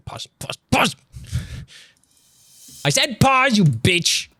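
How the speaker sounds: high-pitched scolding burst; irritated outburst, pitch jumping up; exasperated command. Real, not acted. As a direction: snap at a disobedient gadget. Scolding the player that won't pause.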